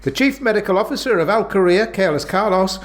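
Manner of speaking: ad read. Acted. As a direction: sell it like a radio jingle host. Mock advert read.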